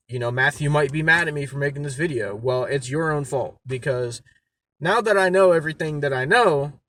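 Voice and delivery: ordinary talk, nothing marked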